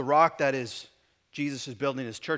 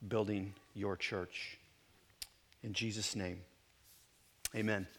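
prayer; 5 semitones lower than usual. Acted. Pastor prays before the sermon.

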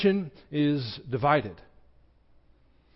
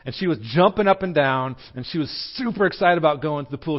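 ordinary talk, nothing marked

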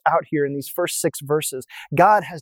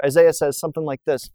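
ordinary talk

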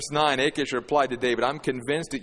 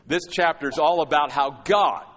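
ordinary talk, nothing marked

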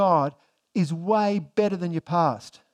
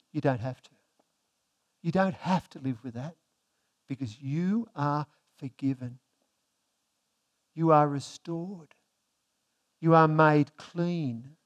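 God's reassurance; 3 semitones lower than usual. Acted. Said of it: "what does God say to him?".

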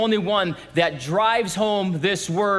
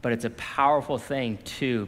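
ordinary talk

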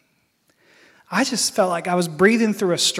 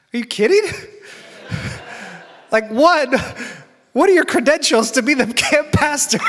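incredulous, laughing it off; laughing disbelief; 6 semitones higher than usual. Retold or imitated. Recalling the camp pastor's answer, laughing disbelief.

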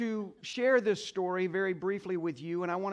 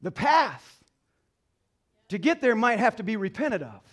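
louder, higher preacher's cry; preaching at full force; 4 semitones higher, 7 dB louder than usual. Acted. Pastor urging the mothers in the congregation, preaching loudly. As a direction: lift the church with rising conviction.

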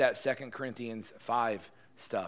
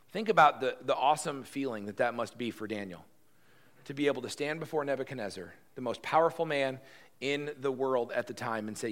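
usual speaking voice

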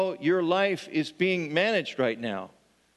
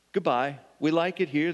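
ordinary talk, nothing marked

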